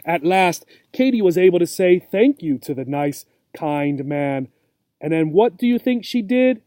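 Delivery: usual speaking voice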